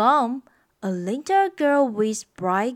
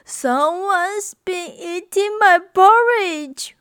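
put-on wailing Baby Bear; 7 semitones higher, 4 dB louder than usual. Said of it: "wailed Baby Bear".

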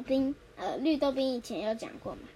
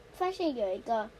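usual speaking voice

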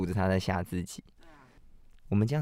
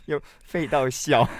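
laughing; 9 semitones higher, 6 dB louder than usual. Real, not acted. Laughing at the joke.

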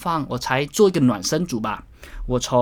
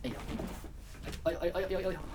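effortful grunts; 5 semitones higher, 11 dB quieter, much faster than usual. Real, not acted. Straining through the fly motion.

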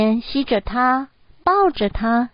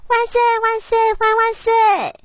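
high-pitched jubilant hurrah; happy cheer; 13 semitones higher, 4 dB louder than usual. A narrator's reading. Boy cheers over his new handkerchiefs, high and joyful. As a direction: cheer it out high like a delighted child. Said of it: "阿文高兴地说".

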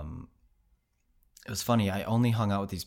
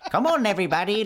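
high, loud laughing Wisconsin call; 14 semitones higher, 8 dB louder, faster than usual. Retold or imitated. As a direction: call the group in a squeaky Wisconsin voice. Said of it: "high-pitched voice".

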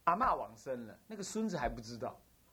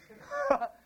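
high laugh; 3 dB louder than usual. Real, not acted. Laughs at failed rebirth.

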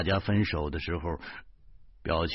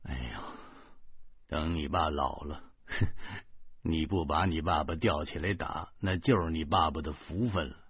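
low, soft, dejected grumble; hushed, resigned remark to oneself; quiet aside; 5 semitones lower, 7 dB quieter than usual. A narrator's reading. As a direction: grumble it under your breath. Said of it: "摇着头小声说".

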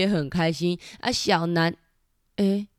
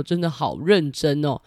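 plain reading voice

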